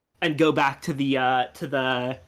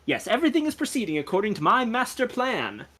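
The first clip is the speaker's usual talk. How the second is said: gentleman sleuth announcing it grandly, higher pitch; self-assured boast; 7 semitones higher than usual. Acted. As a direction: boast lightly in a theatrical gentleman's voice. Gentleman boasts of his plan.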